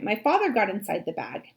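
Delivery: usual speaking voice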